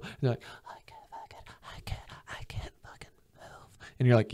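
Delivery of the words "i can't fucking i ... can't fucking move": whispered imitation of chattering teeth; mock freezing. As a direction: imitate someone too frozen to speak aloud. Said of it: "teeth chattering".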